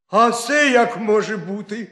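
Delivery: a hare who can't believe it; shocked hare crying out; astonished cry. A narrator's reading. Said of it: "скрикнув заєць".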